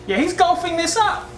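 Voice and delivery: very high excited exclamation; excited outburst. Real, not acted. Host reacting to the golf ad, excited outburst. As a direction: call it out in a high-pitched burst.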